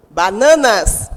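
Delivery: "bananas" with the middle NA punched; stress on NA. Shown as a demonstration. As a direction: hit the second syllable of "bananas", NA. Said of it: "a sílaba mais forte que eu pronuncio". ("bananas" com o NA do meio bem forte)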